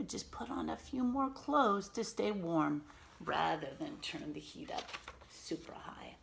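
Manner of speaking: plain reading voice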